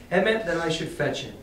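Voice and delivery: ordinary delivery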